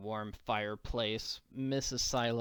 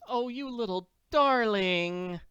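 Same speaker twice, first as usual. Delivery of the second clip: put-on higher, fond old-lady voice; doting farm wife; 8 semitones higher, 4 dB louder than usual. Missus Silo hugs Annie, high and doting. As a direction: gush over a child you missed.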